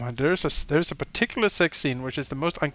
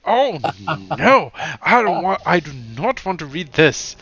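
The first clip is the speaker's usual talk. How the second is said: higher and louder, recoiling in disgust; disgusted protest; 3 semitones higher, 5 dB louder than usual. Retold or imitated. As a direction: plead to make it stop. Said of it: "you're like".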